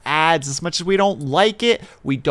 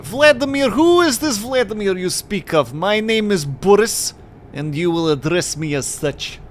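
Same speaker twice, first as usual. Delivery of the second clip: indignant, higher-pitched mock Russian accent; bad Russian accent; 4 semitones higher than usual. Boris correcting whoever called him Vladimir, bad Russian accent. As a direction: object haughtily in a hammed-up Russian accent. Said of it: "a really bad Russian accent".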